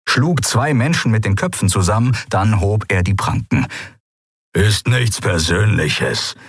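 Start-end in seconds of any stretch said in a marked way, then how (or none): 4.5-6.4 mild growl. A narrator's reading. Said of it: "knurrte er".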